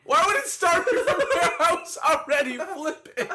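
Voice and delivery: high, laughing whine of protest; laughing complaint. Real, not acted. The rewind fails and he protests, laughing. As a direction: complain while cracking up.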